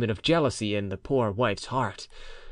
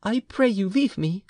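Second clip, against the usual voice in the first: murmured wife; 7 semitones higher than usual. A narrator's reading. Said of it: "she murmured".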